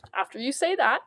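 usual speaking voice

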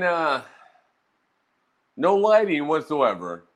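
normal talking voice

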